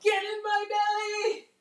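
high, slow hammed-up greedy cry; mock-whine at the food; playful glutton voice craving the food. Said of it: "I'm like".

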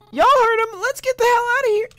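very high, loud, hurried character voice; squeaky urging. Acted. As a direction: squeal it while rushing everyone out. Voicing a fleeing game character.

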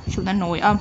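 ordinary delivery

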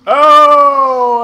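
long, high, loud "oh"; excited exclamation. Real, not acted. Gamer levels up the prize fighter, loud excited oh. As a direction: burst out with delight.

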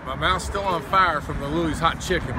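ordinary talk, nothing marked

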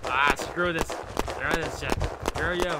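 loud frustrated yelling; real rage at the game; angry shouting. Real, not acted.

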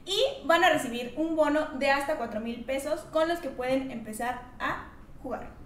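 ad read. Acted. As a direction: read the sponsorship smoothly. Sponsor ad read.

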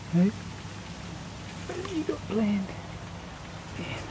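ordinary talk, nothing marked

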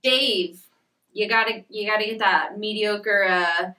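ordinary delivery

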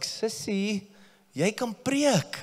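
high, slow cheer to a little sister in Afrikaans; encouraging sister. Retold or imitated. Father urging his daughter to preach, high and slow. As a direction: coax her gently upward: you can preach. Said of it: "I was like".

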